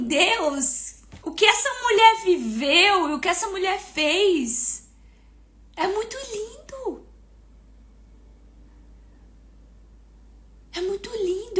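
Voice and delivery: high, softening, tearful awe; moved near tears. Retold or imitated. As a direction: marvel to God, welling up. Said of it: "só de falar me dá vontade de chorar".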